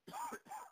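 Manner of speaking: real hoarse cough. Real, not acted. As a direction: cough mid-talk from being hoarse. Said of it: "pardon my coughing".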